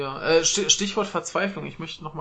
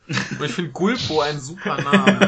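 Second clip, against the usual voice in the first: laughing; 7 dB louder, slower than usual. Real, not acted. Amused by the boss name.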